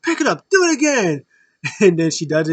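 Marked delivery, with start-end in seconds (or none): 0.0-1.2 much higher, louder hammed-up command; mock bossy heel. Brody Lee telling Sue to redo the throw. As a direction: order her around in character. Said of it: "He's like".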